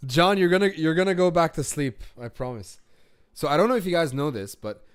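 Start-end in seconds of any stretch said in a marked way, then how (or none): 0.1-2.0 teasing call. Real, not acted. Teasing someone half-asleep.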